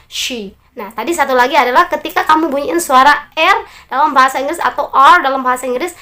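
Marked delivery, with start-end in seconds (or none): 0.1-0.6 soft, low "she" with lips pushed out; "she" with rounded lips. Shown as a demonstration. Teacher shows mouth shape, soft pouted "she". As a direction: pout slightly on the sh of "she". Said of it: "mulutnya agak monyongin dikit".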